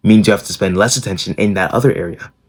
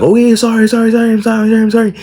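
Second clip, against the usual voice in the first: high, louder panicked apologies; frantic pleading; 4 dB louder, faster than usual. Retold or imitated. Scared boy pleading with his mom holding a belt, high and frantic. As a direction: beg to stop the belt in a high pleading rush. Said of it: "And I'm like".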